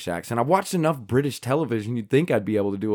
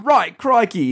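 loud, higher mock British; pretend British accent; 9 semitones higher, 5 dB louder, much slower than usual. Joking about pretending to be British in a movie, exaggerated British accent. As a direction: exclaim it in a hammed-up British accent. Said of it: "pretend to be a British person".

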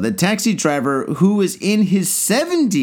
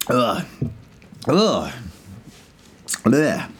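gagging ugh after a bad sip; disgusted grunt; 5 semitones lower, much slower than usual. Real, not acted. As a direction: groan in disgust at the taste. Disgusted after drinking water beer.

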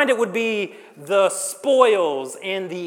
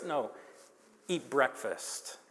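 low, soft, unhurried invitation; gentle welcome; 5 semitones lower, 10 dB quieter, slower than usual. Retold or imitated. Jesus receiving the disciples who betrayed him, gentle welcome. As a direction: drop it down and ease off. Gentle correction of the mock rebuke.

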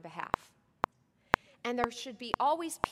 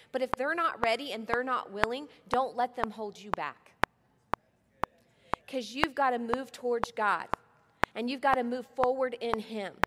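normal talking voice

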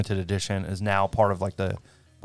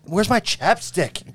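slow high whine, a mock teenager; drawn-out bratty teen complaint; whiny teen voice; 9 semitones higher, slower than usual.

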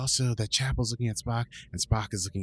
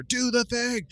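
much higher, louder urgent shout; 12 semitones higher, 4 dB louder, slower than usual. Retold or imitated. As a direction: yell the order from inside the pod. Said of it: "she shouts at Hammer".

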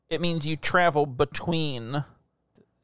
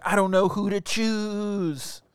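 Jay Leno; 5 semitones higher, slower than usual. Retold or imitated. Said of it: "Are you Leno in this?".

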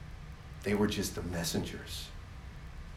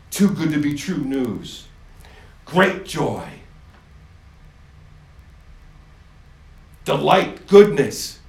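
louder and higher to joyful cry; rising proclamation; 5 semitones higher, 12 dB louder, slower than usual. Acted. God announcing good tidings, building loud joy.

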